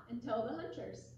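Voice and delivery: plain reading voice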